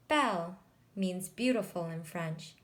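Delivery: ordinary delivery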